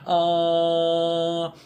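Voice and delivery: a long open back ah. Shown as a demonstration. Teacher demonstrates the dentist vowel ah. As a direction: open wide and hold ah. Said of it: "please open your mouth".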